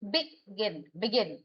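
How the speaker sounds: Begin with the weight on गिन; stress on second syllable. Shown as a demonstration. Teacher demonstrating verb stress to students, stress on गिन in begin. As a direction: land on गिन in begin, falling. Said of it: "गिन पे आपका है".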